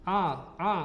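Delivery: "A, a" pushed through the nose; showing the common nasal-hamzah error; nasal hamzah mistake. Shown as a demonstration. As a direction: demonstrate the wrong, nasalised "a". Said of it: "diucapkan dari hidung".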